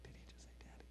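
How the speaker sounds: soft amazed whisper. Retold or imitated. Husband whispering to wife in wonder, hushed amazed whisper. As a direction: breathe it so only your wife hears.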